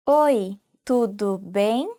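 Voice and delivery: Oi, tudo bem drawn out slowly for learners; slow learner repeat. Shown as a demonstration. Teacher slowing down so students can repeat. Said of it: "you can say".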